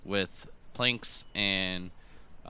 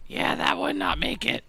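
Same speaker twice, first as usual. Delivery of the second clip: goblin voice; 5 dB louder than usual. Acted. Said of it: "he goes".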